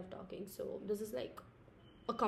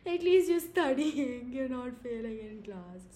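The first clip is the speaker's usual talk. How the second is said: parent voice; 3 semitones higher, 8 dB louder than usual. Retold or imitated. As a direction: boom it out like a proud parent. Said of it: "They're like".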